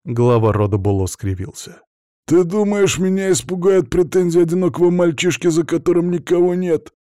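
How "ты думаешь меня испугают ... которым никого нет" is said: high, incredulous mocking question; cynical sneer. A narrator's reading. Bolo dismissing a lonely boy's claims, cynical. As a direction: scoff at the threat, pitch held high. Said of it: "Цинично усмехнулся он".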